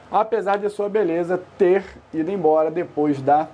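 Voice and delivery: ordinary talk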